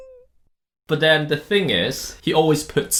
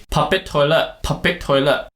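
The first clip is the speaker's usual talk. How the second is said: "Public toilet" in a Malaysian English accent, raised pitch; 4 semitones higher than usual. Shown as a demonstration. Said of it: "say it in a very Malaysian tone".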